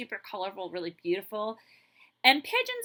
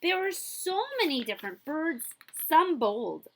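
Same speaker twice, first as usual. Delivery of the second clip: bright, lively storybook reading; animated read-aloud for kids; reading to children; 3 semitones higher, 6 dB louder than usual. A narrator's reading. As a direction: read it like a picture book to a class.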